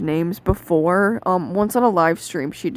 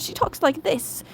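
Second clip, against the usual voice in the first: high-pitched, softer imitation; Kersey; 8 semitones higher, 4 dB quieter than usual. Shown as a demonstration. Reviewer imitating a character's voice, high-pitched. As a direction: pitch it way up into a light put-on voice. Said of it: "Kersey is more high-pitched".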